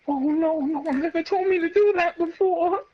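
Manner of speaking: high, sarcastic mock fright; mock scared thug. Voicing mocked mafia thug.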